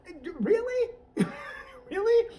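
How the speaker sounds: mock disbelief. Real, not acted. Mocking disbelief.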